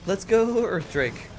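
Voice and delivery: loud high cheer; rally your unit loudly. Real, not acted.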